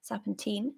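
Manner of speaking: put-on "serpentine" with the last syllable like "teen"; alternative pronunciation. Shown as a demonstration. Reader checks a doubtful word, "serpentine" ending like "teen". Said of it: "is it serpentine or serpentine".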